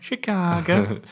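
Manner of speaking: "Chicago" drawn out slowly in a put-on Chicago accent. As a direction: stretch "Chicago" out slowly with a mock Chicago twang. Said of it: "I did like that accent".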